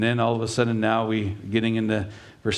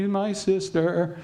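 higher, slow put-on voice; drawn out sly answer; lightly voiced husband lying; voiced Isaac; 9 semitones higher than usual. Preacher voices Isaac answering guards.

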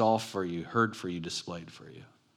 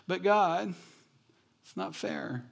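high-pitched whine, trailing off softer; whiny voice; 7 semitones higher, 4 dB quieter, slower than usual. Acted. Believer complaining to God the next day, whiny. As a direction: whine it like a put-upon child. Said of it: "that's my whiny voice to God".

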